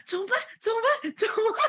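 high, panicky repeated what now; retold panic. Two friends stuck on a no-scooter road, panicking high and laughing. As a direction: squeak out the panic, half laughing.

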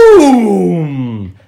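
loud booming ba-doom; explosion sound. A narrator's reading. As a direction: shout it like a blast.

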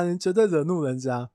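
usual speaking voice

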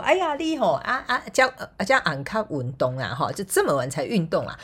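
high, fussing mother scolding; nagging mom lecture. Retold or imitated. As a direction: nag like a worried mom. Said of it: "如果是我妈啦哈，她可能呢哈就会跟我讲说".

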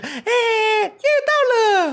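put-on high, very slow wailing child voice; a sobbing toddler cheering on landing; crying child suddenly cheerful. Toddler on the plane, high drawn-out whine. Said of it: "然后那小孩子".

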